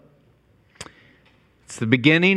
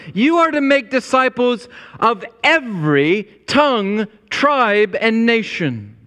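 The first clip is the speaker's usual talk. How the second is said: tongue, tribe, and nation called out higher; fervent proclamation; 7 semitones higher than usual. Acted. Pastor proclaiming the mission to his church, fervent call. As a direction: ring out each word of the list. Fervent list, then hushed repeat.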